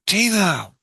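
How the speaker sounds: loud, high call for someone. Retold or imitated. Dreamer calling for Tina, whom he can't see, calling out. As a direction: call the name as if to another room.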